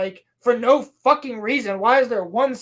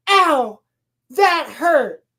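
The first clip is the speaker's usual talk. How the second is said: higher, louder complaint of pain; mock pained yelp; 4 semitones higher, 5 dB louder, much slower than usual. Girl reacts to blood sample, mock pained. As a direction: protest a little sting, playacting.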